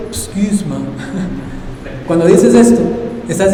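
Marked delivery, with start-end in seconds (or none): 0.1-0.8 a quiet English-accented excuse me; English phrase. Shown as a demonstration. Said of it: "cuando tú dices". 0.8-2.0 soft laugh. Real, not acted.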